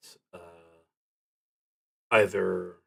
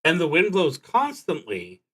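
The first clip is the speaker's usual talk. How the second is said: higher, louder read of the prompt, like an announcement; reading the card aloud; 9 semitones higher, 6 dB louder than usual. Acted. Reading the drawn card's prompt to the audience, higher and louder. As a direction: read the card text out clearly, raised and projected.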